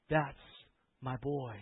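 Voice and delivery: ordinary talk, nothing marked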